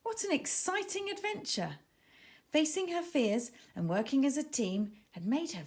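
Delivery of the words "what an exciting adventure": usual speaking voice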